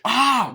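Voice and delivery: loud, high mock scream; pained scream. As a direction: scream as if just slashed. Imitates cry as Mike is cut.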